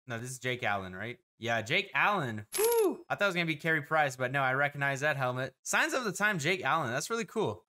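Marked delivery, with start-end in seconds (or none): 2.6-3.0 high celebratory "woo"; excited whoop. Real, not acted. Card opener celebrating an autographed pull, excited whoop. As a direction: let out a high, happy whoop.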